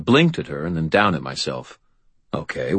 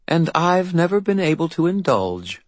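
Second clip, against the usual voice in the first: fairy godmother voice; 11 semitones higher than usual. A narrator's reading. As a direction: look down your nose at him. [name]'s voice.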